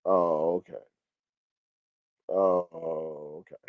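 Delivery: very soft, low "oh, okay"; muted acknowledgement. Real, not acted. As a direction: murmur it low, almost to yourself. Muted reaction while reading the chat.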